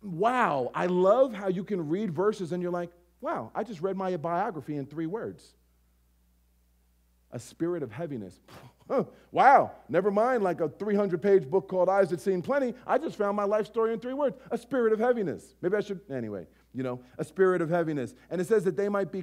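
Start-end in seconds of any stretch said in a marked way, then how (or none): none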